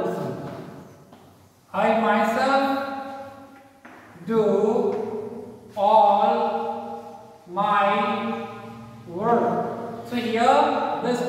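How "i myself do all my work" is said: halting, one word at a time; dictating. Shown as a demonstration. Teacher writing an example for children, word-by-word dictation. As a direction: leave long gaps as if writing each word.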